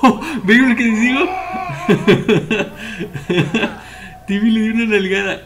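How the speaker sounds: talking through loud laughter; loud amused laughter; laughing. Real, not acted. Laughs at Timmy's antics.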